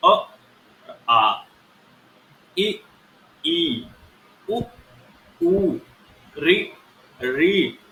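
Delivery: अ short then आ held twice as long, pair by pair; short and long vowels. Shown as a demonstration. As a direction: contrast each short vowel with its doubled long one. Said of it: "मैं दोनों को बोल के दिखाता हूँ". (ह्रस्व और दीर्घ स्वर का अंतर दिखाते हुए)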